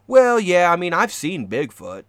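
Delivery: loud, high blurt, easing off; imitating a sudden blurt. Contestant to his shelter partner out of nowhere, imitated loud blurt. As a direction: blurt it out loudly, high at the start.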